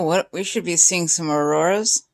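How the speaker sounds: usual speaking voice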